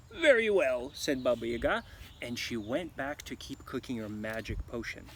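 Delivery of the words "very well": loud, higher witch reply. Acted. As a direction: answer like a satisfied witch. Witch answers.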